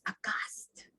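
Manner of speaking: soft unvoiced gasp of horror; horrified whisper. Shown as a demonstration. Teacher repeats the word in a horrified whisper. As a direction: whisper it as if frozen in fright. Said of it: "the way I'm saying it".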